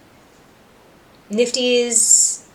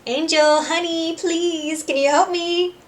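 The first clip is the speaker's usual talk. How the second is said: pleading manipulator; 8 semitones higher than usual. Retold or imitated. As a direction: sweet-talk him for help. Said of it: "Val does the little".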